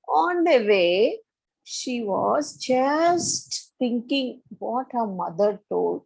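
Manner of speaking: ordinary delivery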